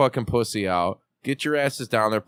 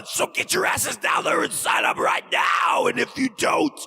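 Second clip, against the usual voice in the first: much higher, shouted, raspy villain promo; wrestling promo threat; 12 semitones higher than usual. Shown as a demonstration. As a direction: threaten them like a heel on the mic. Over-the-top promo threat.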